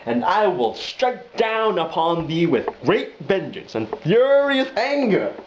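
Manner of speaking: slow, thundering high declamation; Samuel Jackson. Acted. Hitman's epic line before firing, furious thundering. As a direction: build to a furious shouted climax. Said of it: "this is the epic line where he goes".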